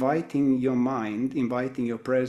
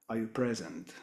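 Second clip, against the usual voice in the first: ordinary talk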